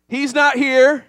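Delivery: loud, high call for a response; call to congregation. Shown as a demonstration. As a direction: lead the crowd's chant. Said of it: "you have to respond with".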